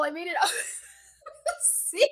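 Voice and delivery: laughing through the words, breaking into a giggle. Real, not acted. Telling her co-host about her statistics grade, laughing. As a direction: laugh it out, half crying with glee.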